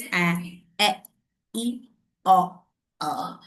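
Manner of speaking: "ए, ए, ई, ओ, अ" as short, separate vowel sounds. Shown as a demonstration. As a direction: demonstrate "ए, ए, ई, ओ, अ" as short vowels, pausing between each. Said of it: "short वॉल sound is". ("ए, ए, ई, ओ, अ" छोटे स्वर, अलग-अलग बोलकर)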